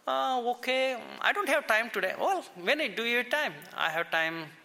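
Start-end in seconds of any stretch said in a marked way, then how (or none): 0.1-2.1 noticeably higher, lightly voiced villager brushing off the pastor; quoted villager declining. Villager's excuse retold in dialogue. 2.2-3.5 higher and quicker, lightly voiced pastor pressing; quoted pastor asking. Pastor presses in retold dialogue.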